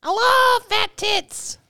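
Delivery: very high squeaky pet voice; cat voice. Acted. Voicing the cat.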